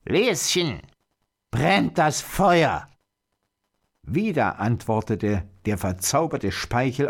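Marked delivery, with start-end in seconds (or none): 0.0-2.9 high, drawn-out witch's call; stretched crone's shout, pitched up; old witch calling. A narrator's reading. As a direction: call out slowly from across the hut. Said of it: "rief die Alte abermals".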